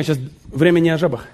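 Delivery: ordinary delivery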